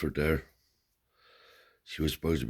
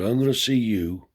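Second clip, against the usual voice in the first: higher, louder and slow; grandfather's voice; 7 semitones higher, 6 dB louder than usual. Retold or imitated. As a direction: declare it slowly, grandly. Grandfather makes generous promise.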